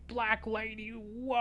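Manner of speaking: high, drawn-out mock complaint; drawn-out mocking whine; mocking outcry. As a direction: stretch it out like a mocked complainer. Mimics hypothetical complainers.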